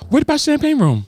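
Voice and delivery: high-pitched, put-on Indian accent. Acting a naked strip club customer asking the bouncer, mock Indian accent. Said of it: "gave to my man an Indian accent".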